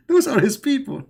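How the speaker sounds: squeaky high laugh through the words. Real, not acted. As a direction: laugh while scoffing. Laughs between mocking lines.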